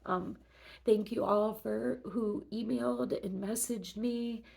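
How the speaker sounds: normal talking voice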